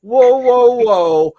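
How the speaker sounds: imitating a startled friend; startled Joe. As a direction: back off in mock alarm. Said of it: "Joe's like".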